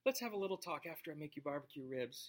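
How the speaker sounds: butter him up softly; wife sweet-talking her husband, soft and coaxing. Pretend. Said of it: "you know".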